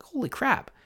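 high-pitched mock exclamation of surprise; retold amazement. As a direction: blurt it out, surprised. Inner quote of amazement.

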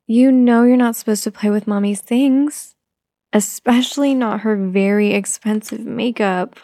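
normal talking voice

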